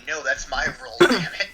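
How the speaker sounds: loud mock outrage, rising at the end; indignant protest. Child actor claiming the role on Twitter, mock indignant. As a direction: protest with comic indignation, punching the last words.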